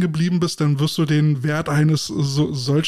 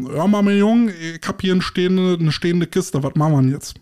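Berlin caretaker voice; 4 semitones higher than usual. Retold or imitated. Berlin caretaker calls the hotline about a stuck lift, broad Berlin accent. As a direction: play the gruff Berlin caretaker in heavy Berlin dialect. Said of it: "richtige schlonzige Art".

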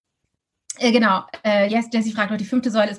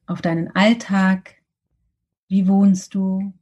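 guided meditation; 3 semitones lower than usual. Acted.

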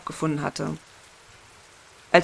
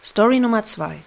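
announcer; 4 semitones higher, 5 dB louder than usual. Acted. Announcing next story.

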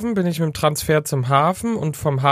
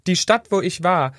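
ordinary talk, nothing marked

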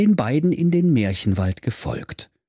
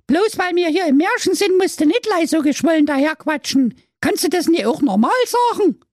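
put-on much higher scolding girl voice; little sister; 4 dB louder than usual. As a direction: tell him off like a kid sister. Sister figure.

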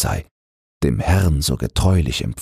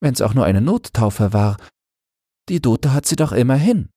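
put-on higher girl's voice, mildly reproachful; a girl finding it unkind, pitched higher; Christine disapproving; 9 semitones higher than usual. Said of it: "meinte Christine". (Christine, höhere Stimme, leicht vorwurfsvoll)

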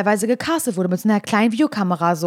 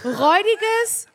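hoch und langsam, übertrieben empörte Schimpftirade; gespielte Beschimpfung; 9 semitones higher, slower than usual. Acted. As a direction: jedes Schimpfwort genüsslich dehnen, ein gespielter Wutausbruch. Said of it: "wie böse wir das sagen". (im Spaß gespielte Schimpftirade gegen Simon, hoch und gedehnt)

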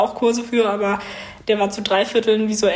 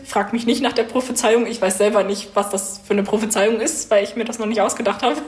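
ordinary talk

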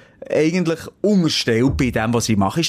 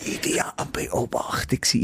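whispered aside; 5 semitones lower, 7 dB quieter than usual. Real, not acted. Quiet unvoiced aside.

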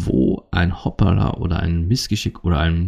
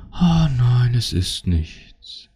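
high sighed start, very slow fade; exaggerated, airy nothing's-wrong act; mock martyred denial; 5 semitones higher, much slower than usual. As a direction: drag it out like a hammed-up sulker. Mocked cliché of partner denying problems.

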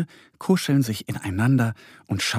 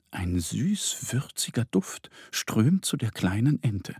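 usual speaking voice

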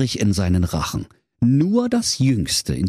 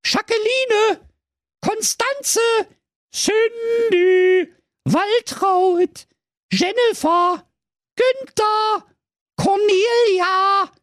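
high calling mother voice; calling out worried; much slower than usual. A narrator's reading. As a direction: call each name, worried. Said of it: "rief sie ... besorgt".

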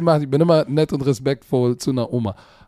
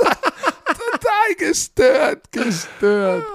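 high laugh into stretched "Total gestört"; real laughter; laughing hard; 11 semitones higher, much slower than usual. Real, not acted. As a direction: giggle the words out. Laughs at own story.

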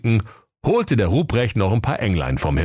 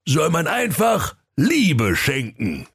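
high, declaimed magic spell; Waldemar Weihnachtsmann; 10 semitones higher than usual. A narrator's reading. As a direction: chant the rhyme as an incantation, pitched up. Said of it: "besann sich ... auf den Schrottwichtel-Gegenzauber".

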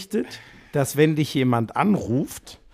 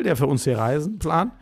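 ordinary delivery

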